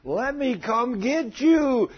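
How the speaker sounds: high, slow, gloating devil voice, savoring the catch. Acted. Preacher voicing the devil spotting a sinner, high and gloating. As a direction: purr it like a devil who found his prey. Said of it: "he looks and he says".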